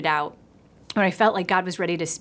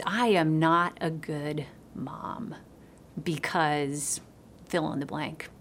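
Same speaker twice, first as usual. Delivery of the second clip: self-doubting inner voice; 3 semitones lower, 6 dB quieter, slower than usual. Retold or imitated. Quoting her inner critic.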